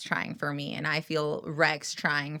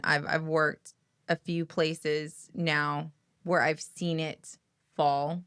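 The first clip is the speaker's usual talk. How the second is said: ordinary talk, nothing marked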